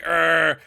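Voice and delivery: growled troll noise made by mouth; imitated troll roar. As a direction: give a short mock monster roar. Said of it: "it's like".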